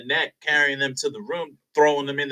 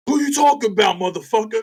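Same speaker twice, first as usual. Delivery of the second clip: higher-pitched mock aggressive challenge; retold confrontation; 6 semitones higher than usual. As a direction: act out an angry man squaring up to someone. Acting out Russell confronting Dwayne.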